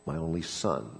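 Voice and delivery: a father breaking the news of his son's death; hushed, sorrowful confession; grieving doctor. Acted. Doctor confesses losing his son.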